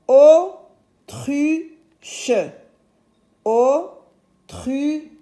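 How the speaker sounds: autruche high, in three separated beats; syllable by syllable. Shown as a demonstration. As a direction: break "Autruche" apart: au, tru, che. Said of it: "O dans la première syllabe". (autruche découpé, pause entre les syllabes)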